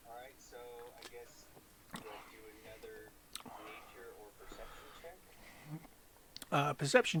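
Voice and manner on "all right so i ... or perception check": fast hushed whisper. Real, not acted. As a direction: whisper so nobody hears.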